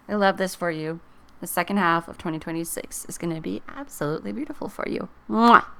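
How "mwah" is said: loud, smacking "mwah"; exaggerated farewell kiss; blown kiss. Real, not acted. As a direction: send a big audible kiss. Blows a kiss at sign-off.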